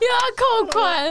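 high, whimpering mock sob; put-on tearful lament; fake bawling. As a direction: pretend to wail over lost money. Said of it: "就痛哭吗".

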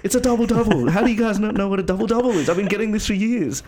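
high-pitched mock tirade; very angry customer. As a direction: imitate an irate customer, pitch way up. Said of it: "he got so angry".

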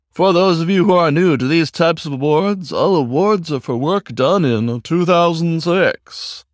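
formal host reading the rules; announcer voice. Acted. Aardvark host explaining awards.

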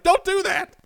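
high, loud mock telling-off; mock scolding. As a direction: bark it like a fed-up scold. Scolding himself, mock plumber voice.

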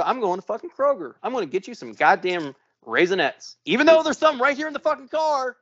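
rising, high-pitched exasperation, retold; exasperated retort. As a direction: build to an incredulous high-pitched gripe. Retold exasperated reply to his wife.

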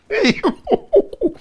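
very high whooping laugh; delighted falsetto hoot; high-pitched hoot. Real, not acted. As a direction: whoop high at the trash talk. Hoots at the retold trash talk.

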